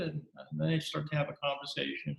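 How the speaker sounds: normal talking voice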